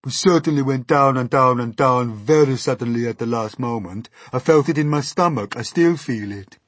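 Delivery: complaining put-on worm voice; gloomy earthworm. A narrator's reading. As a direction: lament at length as the woeful worm. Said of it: "the earthworm said gloomily".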